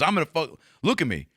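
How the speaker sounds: mock a cop demanding attention; aggressive cop. Cop threatening the kid, mildly aggressive. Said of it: "He goes".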